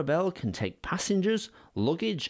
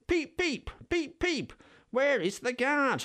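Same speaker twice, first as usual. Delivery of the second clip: much higher whistle-like peeps; Thomas whistling; 12 semitones higher than usual. Acted. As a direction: toot it like a train whistle. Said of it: "whistled Thomas".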